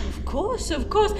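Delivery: mocking echo.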